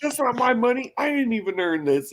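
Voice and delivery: very high, shrill mock scolding; over-the-top father ranting at his son; hammed-up outraged dad. Acting John scolding son.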